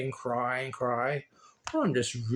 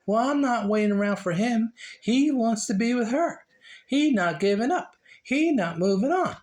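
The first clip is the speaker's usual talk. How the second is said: higher, louder mock indignation; fed-up quote; 7 semitones higher, 3 dB louder than usual. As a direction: voice the friends giving up on him, higher. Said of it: "They say".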